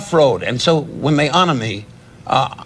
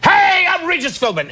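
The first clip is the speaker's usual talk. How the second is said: Regis impression. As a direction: announce yourself like a TV host. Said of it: "the kind of guy that goes".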